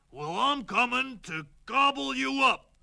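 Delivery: troll bellowing at the little goat; menacing troll. Acted. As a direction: loom over him and threaten to eat him. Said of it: "said the troll".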